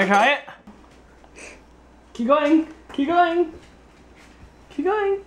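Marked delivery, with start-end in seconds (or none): 2.1-5.2 rising cheer. Real, not acted. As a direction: keep going, climbing higher. Cheering child drinking through straw.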